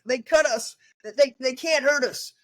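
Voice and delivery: a boxer's defiant shout; Cuba Gooding Junior quote. Retold or imitated. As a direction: quote the fighter's defiant line. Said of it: "from the movie, um, Pearl Harbor … Cuba Gooding Junior".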